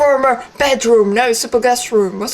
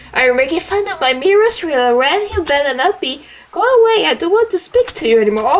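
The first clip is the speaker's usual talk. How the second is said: angry sister, higher and rising to a shout; 6 semitones higher, faster than usual. Acted. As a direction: snap at the little rat, then send them away. Voicing sister character.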